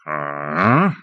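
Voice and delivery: low, drawn sarcastic ah; wizard's sarcastic grunt; heavy sarcasm. A narrator's reading. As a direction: scoff at the idea. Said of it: "with heavy sarcasm".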